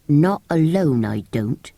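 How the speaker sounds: low, firm refusal. Acted. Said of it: "said Andrew in low, firm tones".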